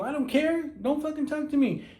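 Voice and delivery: high-pitched acted brush-off; indignant rebuff. Woman to the stranger complimenting her, indignant rebuff. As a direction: imitate a woman shutting him down.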